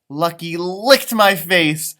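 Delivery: ordinary delivery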